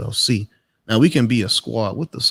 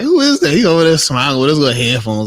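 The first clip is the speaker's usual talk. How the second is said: mock surprise; 3 semitones higher, 6 dB louder than usual. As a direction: squeak it out like a baffled viewer. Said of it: "he's like".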